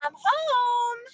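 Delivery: calling out. Acted. Meg arriving home, calling out to the house. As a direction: call out to whoever's home.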